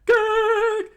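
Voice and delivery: loud, very high yelp; cartoon freeze-up shriek; shocked squawk. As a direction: choke out a startled falsetto cry.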